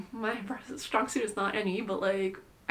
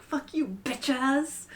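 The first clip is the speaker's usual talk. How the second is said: defiant retort; 4 semitones higher, much slower than usual. Retold or imitated. Defiant retort to spiritual people.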